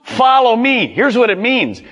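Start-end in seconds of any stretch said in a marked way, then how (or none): 0.0-0.9 high, forceful command. Acted. Jesus orders the fishermen to follow, high firm command. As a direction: call it out as an order, each word set apart. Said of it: "Come was a command".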